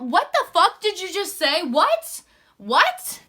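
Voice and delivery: loud, high, incredulous questioning; mock-outraged double take; acted disbelief. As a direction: demand it as if you misheard. Acted incredulous reply to the captain.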